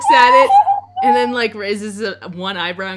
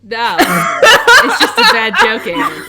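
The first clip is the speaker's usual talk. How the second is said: high, loud, helpless laughter through the words; laughing; 13 semitones higher, 12 dB louder, slower than usual. Real, not acted. Game master cracking up at Eamon's bad joke, laughing loudly. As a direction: crack up while saying it.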